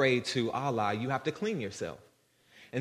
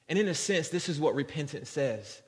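normal talking voice

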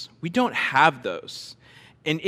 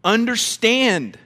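Understand stretched slow, high and loud; slow, loud, emphatic Understand; command; 6 semitones higher, 5 dB louder, much slower than usual. Shown as a demonstration. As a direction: land Understand as a firm order. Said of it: "What's the next command?".

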